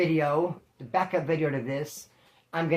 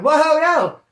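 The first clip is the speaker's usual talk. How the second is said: much higher and louder, startled; alarmed no; 10 semitones higher, 10 dB louder than usual. Retold or imitated. Refusing his friend's violent plan, startled yell. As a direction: recoil from a terrible idea.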